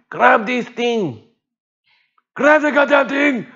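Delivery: high, slow, strained shout down to the water; strained urgent call. Retold or imitated. Leaning over the boat, calling high and strained to the diver. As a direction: reach down and urge him to grab hold. Urging Darius to grab his foot.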